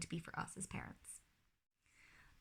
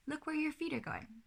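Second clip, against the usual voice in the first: gentle reminder; 9 semitones higher than usual. Shown as a demonstration. Parent guiding climbing child to watch his feet. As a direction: guide the child's attention kindly.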